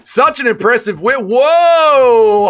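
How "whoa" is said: loud, high drawn-out whoa; a high, loud shocked whoa; stunned whoa. Real, not acted. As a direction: gasp it out in disbelief. Stunned reaction to a finish.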